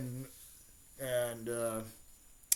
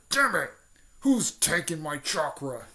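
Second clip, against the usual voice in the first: angry outburst; 3 semitones higher, 5 dB louder than usual. Retold or imitated. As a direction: yell it like the monster is robbed. Nine Tails angry, chakra stolen.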